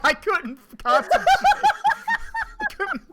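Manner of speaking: breaking up with laughter; laughing too hard to talk. Real, not acted. Laughing at funny line.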